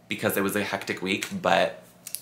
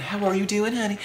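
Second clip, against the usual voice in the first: Southern accent; 11 semitones higher than usual. Shown as a demonstration. Vlogger showing off their Southern character, Southern accent. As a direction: put on a sweet-talking Southern accent. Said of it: "I'm so good at that accent".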